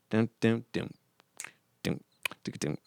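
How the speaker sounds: short sung bass notes; imitated bass line. Artist showing the bass line played on keyboard, vocal imitation. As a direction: voice a sparse bass riff, with gaps. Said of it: "I did a bass line".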